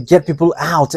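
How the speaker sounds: ordinary delivery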